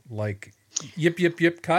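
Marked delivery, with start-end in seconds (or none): 1.0-1.6 high yips like a jackal; yippy jackal imitation. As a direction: yip out like a wild dog. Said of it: "what did jackals sound like".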